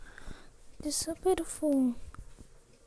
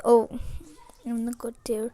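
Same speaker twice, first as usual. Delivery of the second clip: ordinary talk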